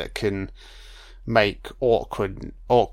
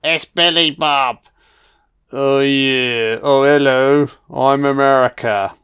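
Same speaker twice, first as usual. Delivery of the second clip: mock president; 3 semitones higher, 7 dB louder than usual. Mocking the American president character.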